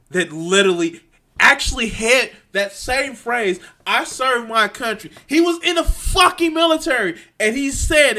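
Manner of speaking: loud, climbing, high outraged telling; heated indignation. Real, not acted. Ranter citing the lieutenant's military service, indignant. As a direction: drive it upward with real indignation.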